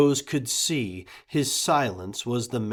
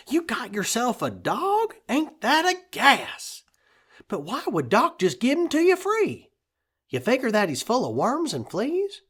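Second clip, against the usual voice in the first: Thomas, amused neighbor; 11 semitones higher, 4 dB louder than usual. A narrator's reading. Thomas voiced, higher.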